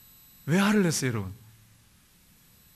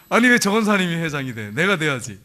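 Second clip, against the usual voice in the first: indignant; 6 dB louder than usual. Acted. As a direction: act out a jealous church member protesting. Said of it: "근데 분노가 막 일어나". (분해서 따지는 흉내)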